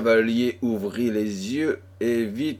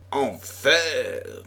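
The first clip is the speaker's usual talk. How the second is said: mild growl of alarm, pitched up; growled curse; 5 semitones higher than usual. A narrator's reading. Chevalier reacts to the girl's cry, growling a mild curse. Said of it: "gronda Pardaillan".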